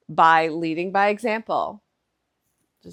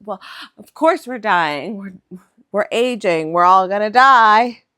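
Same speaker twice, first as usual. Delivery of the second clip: exasperated retort; 9 dB louder than usual. Retold or imitated. Mom's acted retort in argument.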